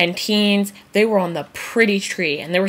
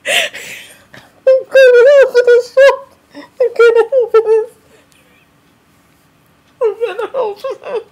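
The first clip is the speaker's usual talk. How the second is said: much higher, slow, laughing; laughing squeal; 7 dB louder than usual. Real, not acted. Vlogger losing it, high laughing squeal. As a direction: squeal through the giggles.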